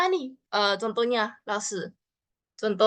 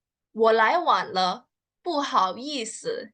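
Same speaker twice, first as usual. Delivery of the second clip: slow, even demo read; slow clear model sentence; slower than usual. Shown as a demonstration. Teacher models the sentence slowly for learners.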